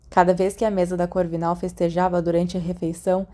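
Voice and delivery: usual speaking voice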